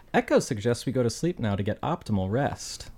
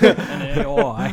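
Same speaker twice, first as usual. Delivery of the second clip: slow, higher, louder laughing Australian; mock Australian; 8 semitones higher, 5 dB louder, much slower than usual. [name] mocking John's slip, in a slow laughing Australian accent. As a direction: drag it out in an Aussie voice, laughing. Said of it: "now I'm Australian".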